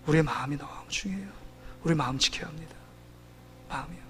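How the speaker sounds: very soft, low, near-whisper; quiet, low-pitched earnest plea; hushed appeal. Real, not acted. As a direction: drop to a hush to press the point gently. Preacher drops to a hush to appeal.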